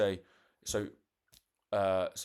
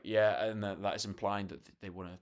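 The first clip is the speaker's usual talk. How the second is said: ordinary talk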